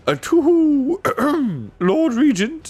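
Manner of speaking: high owl hoot, then throat-clearing. A narrator's reading. Owl addressing the dwarf Lord Regent, hooting. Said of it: "said the owl".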